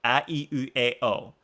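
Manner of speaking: A, i, u, e, o as short pure vowels; Japanese vowel sounds. Shown as a demonstration. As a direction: recite A, i, u, e, o evenly. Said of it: "only Japanese sounds you can make in vowel form".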